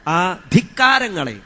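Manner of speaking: usual speaking voice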